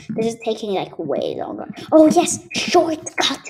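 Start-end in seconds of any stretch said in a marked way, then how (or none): 1.9-3.4 loud, high happy cry; excited. Real, not acted. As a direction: yell it out with glee. Finds a shortcut in game.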